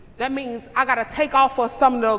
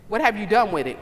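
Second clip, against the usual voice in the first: normal talking voice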